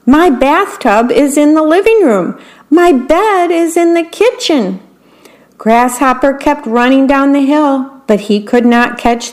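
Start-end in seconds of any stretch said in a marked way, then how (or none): 0.1-4.8 high, dismayed listing; flustered wail. A narrator's reading. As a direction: worm still tumbling, upset. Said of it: "cried the worm".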